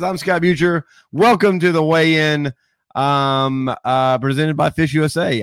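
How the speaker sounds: upbeat host welcome; show host intro. Acted.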